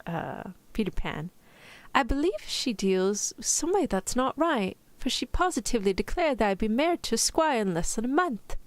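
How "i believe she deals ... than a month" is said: higher-pitched attempted British accent; amateur mock-British reading; Wendy. Shown as a demonstration. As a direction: put on a young storybook heroine's British accent. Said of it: "trying to think of Wendy from".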